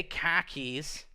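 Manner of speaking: slow, lightly Boston-accented KHAKIS. Shown as a demonstration. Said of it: "like, a Boston accent".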